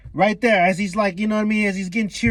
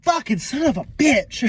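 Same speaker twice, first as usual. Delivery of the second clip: acted exasperation, pitched up high; exasperated cursing; 9 semitones higher than usual. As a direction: curse in hammed-up frustration. Acts characters cursing an escapee.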